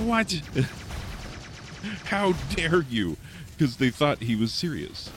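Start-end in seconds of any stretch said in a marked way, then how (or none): 0.1-3.2 high, shocked "What?" with a laugh, then a slow "How dare you?"; mock outrage. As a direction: act scandalised, pitched way up. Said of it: "everybody was like".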